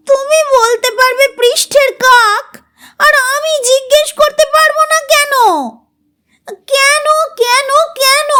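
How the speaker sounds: put-on high, near-crying child voice; tearful arguing. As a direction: argue while close to tears. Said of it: "Runa কাঁদো কাঁদো হয়েও যুক্তি". (কাঁদো কাঁদো গলায় যুক্তি)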